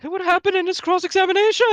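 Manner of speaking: high, loud imitation of a witness; squeaky-loud impression of that guy; imitating a guy. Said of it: "the one guy who talked like this".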